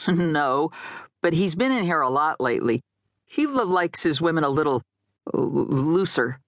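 catching on the L sounds; stammering explanation. Acted. Dead guy explains another man's taste, stammering. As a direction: stutter toward an awkward word.

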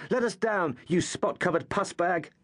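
high mock shout at the bully. Marcus hanging upside down insults the thug, high shout. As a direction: yell up at him, hanging upside down. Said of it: "Marcus shouted".

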